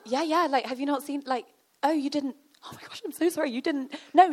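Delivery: high-pitched mock panic; acting out an awkward realisation; flustered. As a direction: backpedal in a high, apologetic fluster. Acted flustered apology in retold argument.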